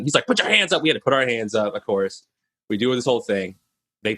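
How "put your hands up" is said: mock yell. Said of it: "So he's yelling".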